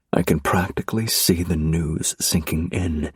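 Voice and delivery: ordinary delivery, nothing marked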